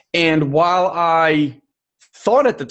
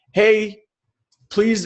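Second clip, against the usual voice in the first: higher, pleading mom voice; fussing mom; 6 semitones higher than usual. Retold or imitated. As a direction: play the worried mother calling after her daughter, pitched up. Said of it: "My mom will say".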